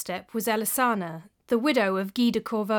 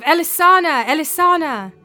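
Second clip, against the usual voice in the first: ghostly cry; 7 semitones higher, 9 dB louder than usual. A narrator's reading. Dead husband crying out for his wife, loud and high. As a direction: cry her name like a dying man. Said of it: "the cries of".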